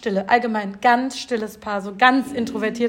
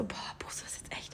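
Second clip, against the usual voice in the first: whispering to herself, unsure; hushed inner voice, hesitating; whispered doubt; 22 dB quieter than usual. Retold or imitated. (geflüsterter Selbstzweifel)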